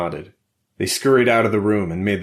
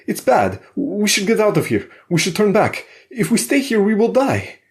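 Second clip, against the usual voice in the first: high, loud and urgent foreign voice; pushing the group to turn back, pitched well up; frightened Alexei; 14 semitones higher, 5 dB louder than usual. Acted. Alexei begging the others to flee, high and loud.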